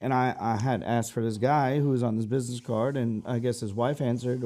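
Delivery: normal talking voice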